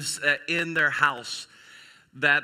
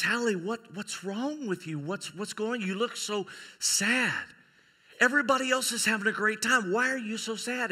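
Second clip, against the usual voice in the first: gently ask, pitched up with worry; concerned father; 6 semitones higher than usual. Acted. Father checks on his sad daughter, gently concerned.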